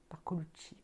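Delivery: whispered name. Real, not acted. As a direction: breathe the name. Speaks the signature's name.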